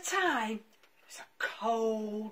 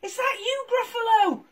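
loud high startled cry; startled puppy; 13 semitones higher, 12 dB louder than usual. Acted. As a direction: jump in fright then ask.

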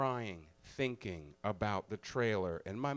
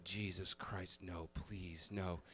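quiet pleading; 6 semitones lower, 11 dB quieter, much slower than usual. Retold or imitated. Dreading the other sergeant.

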